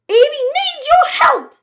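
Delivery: very high, loud yell. Acted. Jack yelling at the front door, very loudly. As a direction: shout it to the house. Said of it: "yells Jack".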